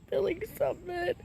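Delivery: high, teary voice breaking on the words; tearful joy. Real, not acted. To her viewers about recovery, crying happy tears. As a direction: sob it out with relief. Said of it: "I started crying on y'all".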